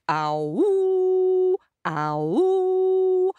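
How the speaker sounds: high hound howl; ringing ah-woo, pitched right up; dog howl. Acted. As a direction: swoop up into a long woo.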